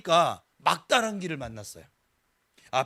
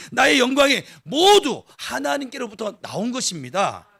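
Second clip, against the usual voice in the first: louder, rising sermon climax; a pastor driving home God's sovereignty, louder and fervent; full-force preaching; 4 semitones higher, 6 dB louder than usual. Acted. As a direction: preach it out to the congregation with conviction.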